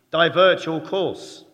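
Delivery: loud, slow barked command; hammed-up American accent ordering the ship to divert; American captain's order. US captain orders the other ship to turn, loud slow American accent. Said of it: "The American says".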